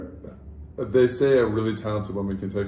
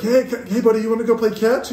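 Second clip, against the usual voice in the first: put-on high, loud dad calling his kid; eager dad; 10 semitones higher, 5 dB louder than usual. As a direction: coax your son in a hammed-up voice. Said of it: "I'll be like".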